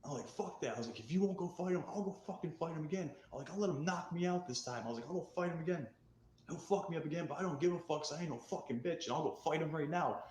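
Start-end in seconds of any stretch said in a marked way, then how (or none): 0.3-0.8 angry. Retold or imitated. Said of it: "'Cause I got mad". 1.0-3.1 higher, heated defiance; retold angry outburst. Storyteller replaying his angry vow to fight, heated. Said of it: "'Cause I got mad". 3.5-4.8 retold defiance. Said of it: "'Cause I got mad". 5.1-10.2 higher, heated tough talk; retold angry defiance. Storyteller replaying his vow to fight again, heated. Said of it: "I'm getting fired up. I'm so angry".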